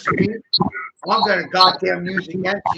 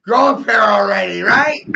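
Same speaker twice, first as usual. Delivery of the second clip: higher and louder, mocking yell; shouted taunt; 3 semitones higher, 5 dB louder than usual. Taunting listeners to grow a pair, shouting. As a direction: throw the dare back as a shout.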